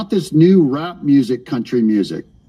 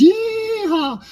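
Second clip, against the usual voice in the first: a high, hammed-up cowboy whoop; mock cowboy yeehaw. Host mocking Texas Republicans with a fake cowboy yeehaw. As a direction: holler it like a parody rodeo cowboy.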